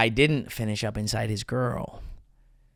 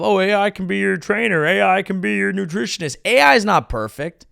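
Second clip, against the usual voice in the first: higher, sing-song mock enthusiasm; mocking hype; 6 semitones higher than usual. Comedian mocking AI hype, mock chipper. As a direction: parody of tech boosters.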